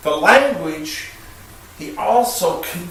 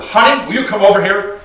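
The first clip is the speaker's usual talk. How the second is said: loud, higher, rushed call to a spouse; sharp demand; 6 semitones higher, 9 dB louder, faster than usual. Shown as a demonstration. Husband summoning his wife the wrong way, loud and demanding. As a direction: call honey over sharply and impatiently. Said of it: "the way I say it".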